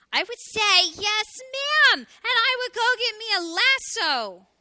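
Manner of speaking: high, loud, drawn-out cry; excited little hedgehog. A narrator's reading. Little hedgehog promising his mother the sword, excited.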